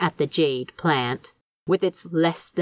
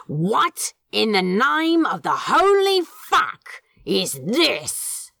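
angry demand; 4 semitones higher, slower than usual. A narrator's reading. Crowley outraged at the twisted coleus stalk. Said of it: "he demanded".

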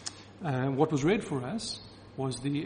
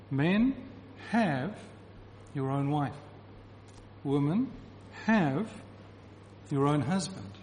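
"have" pitched up and pushed; forceful have. Shown as a demonstration. Preacher showing the force of "have" to listeners, punching the verb. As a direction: press each "have" with force. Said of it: "You hear the force of it".

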